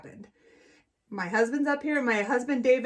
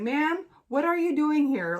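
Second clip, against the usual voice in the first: officer; 5 semitones higher, slower than usual. Retold or imitated. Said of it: "this gentleman's like".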